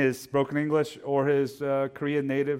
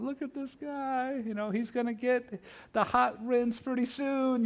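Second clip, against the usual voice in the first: mock video narrator; 13 semitones higher than usual. Teasing the customer like a hushed Instagram narrator. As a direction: narrate the barber's customer like a sly video caption.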